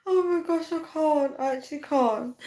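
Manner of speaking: high, teary laughing gasps; helpless with laughter; crying with laughter. Real, not acted. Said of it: "I cannot be calm".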